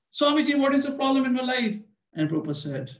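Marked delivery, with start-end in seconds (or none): 0.1-1.8 much higher, louder frightened question; alarmed. Retold or imitated. Frightened man asks Prabhupada about his fate, alarmed. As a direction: anxiously demand what the problem is.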